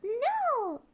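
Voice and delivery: a Norwegian's glad no; delighted no. Shown as a demonstration. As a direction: say no like a warm greeting. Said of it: "what they say is".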